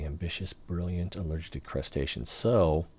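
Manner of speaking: usual speaking voice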